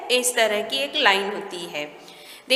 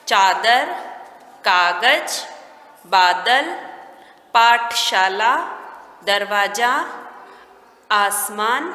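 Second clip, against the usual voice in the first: low, measured, one word at a time; teacher dictating to beginners; word-list reading; 3 semitones lower, much slower than usual. Shown as a demonstration. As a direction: leave a clear pause after each word.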